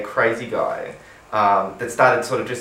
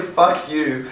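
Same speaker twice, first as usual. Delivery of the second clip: ordinary talk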